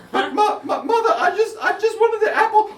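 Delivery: put-on very high, laughing little-girl excuse; girl pleading with mother; stammering child. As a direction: stammer out a whiny apology.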